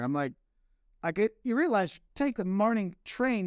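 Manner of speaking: ordinary delivery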